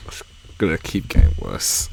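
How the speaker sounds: low eerie whisper; dark whisper behind you. Acted. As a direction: breathe it ominously, unvoiced. Whispered repeat, unvoiced and darker.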